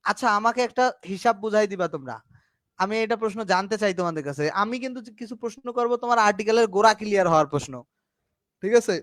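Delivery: normal talking voice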